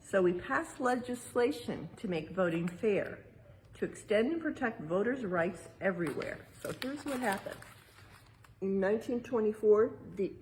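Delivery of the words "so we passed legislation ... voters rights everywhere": rhyming verse read aloud. Acted. Parent reading the exhibit's rhyme to children, lilting read-aloud. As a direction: read the rhyme like a picture book to kids.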